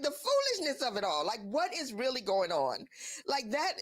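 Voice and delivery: normal talking voice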